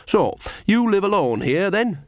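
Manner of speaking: high, bright small talk. A narrator's reading. Nanny making conversation with Mrs Gogol, brightly. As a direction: chirp a cheery, nosy question. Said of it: "she said brightly".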